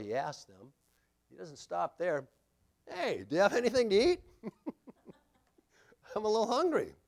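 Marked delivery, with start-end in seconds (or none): 2.9-7.0 casual Jesus. Acted. Jesus voiced casually asking food.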